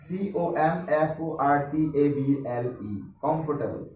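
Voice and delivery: slow, evenly separated letters, then comfortable whole; letter-by-letter spelling. Shown as a demonstration. Teacher spells an unfamiliar word for students, letter by letter. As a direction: spell out every letter before reading comfortable. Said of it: "এই শব্দটা হঠাৎ করে যেহেতু অপরিচিত".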